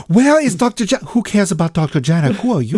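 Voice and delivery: playful fake Russian accent. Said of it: "That's my flawless Russian accent".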